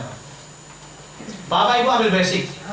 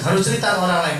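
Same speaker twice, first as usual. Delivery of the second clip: ordinary talk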